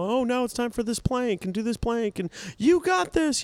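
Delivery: high, bright-pep coach imitation; peppy trainer voice. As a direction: cheer them on like a fitness coach. Mirror trainer voice mocked.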